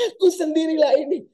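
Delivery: high, loud annoyed it's me; Jesus scolding frightened disciples, exasperated. Acted. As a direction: say it with fed-up impatience. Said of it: "nada geregetan".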